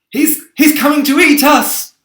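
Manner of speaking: high, loud, stammering fright; panicked wail. A narrator's reading. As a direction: wail it in dread. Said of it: "wailed the old green grasshopper".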